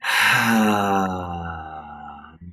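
long open-mouthed sigh out; relieved ha breath; audible ha exhale. Shown as a demonstration. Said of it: "let a ha sound out".

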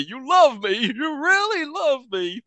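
high, slow gushing acceptance speech; Sally Field parody. Retold or imitated. Mimicking the mask's Sally Field Oscar speech, high and slow. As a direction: gush it out like a teary Oscar winner. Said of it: "he does that Sally Field parody".

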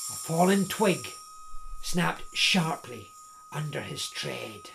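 ordinary delivery

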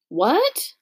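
high, rising exclamation of disbelief; taken aback, voice shooting up; shocked question. A narrator's reading. Girl hears they must leave, shocked question.

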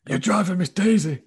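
loud, high northern impression; Sheffield instructor. Shown as a demonstration. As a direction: belt it out as a Sheffield driving instructor. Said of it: "How did he speak to you?".

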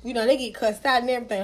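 usual speaking voice

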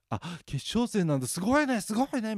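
high, loud gushing to cover a gaffe; exaggerated high cheer covering the awkward slip; overdone excitement. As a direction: gush loudly to drown out the blunder. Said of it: "「すごいね」のテンションですごいかき消す".

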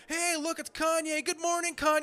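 high, chirpy paparazzo greeting; mock paparazzo. Paparazzo ambushing [name] at his house before dawn, mock cheery. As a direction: imitate an obnoxiously cheery photographer.

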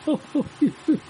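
high nervous giggle; nervous high laugh. A narrator's reading. Reed bed laughs nervously. (risa nerviosa y aguda)

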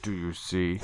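low, eerie killer whisper-tone; serial killer voice. Acted. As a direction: ask it like a calm psychopath. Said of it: "fantastic serial killer voice".

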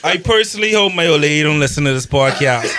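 ordinary delivery

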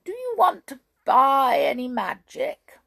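high, loud witch pitch; witch offering magic. A narrator's reading. Witch tempts brownies to buy magic, high and loud. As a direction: tempt them like a peddling witch.

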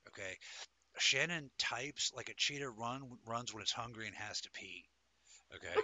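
normal talking voice

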